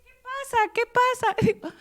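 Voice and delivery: high, slow, worried questioning; lightly voiced worried helper; concerned young man. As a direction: ask anxiously what's wrong. Young man asks what's wrong.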